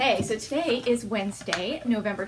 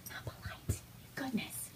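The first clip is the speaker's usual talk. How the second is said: whisper; 4 semitones higher, 13 dB quieter than usual. Real, not acted. Mother whispering a mock scold to her baby. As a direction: breathe it to the little one.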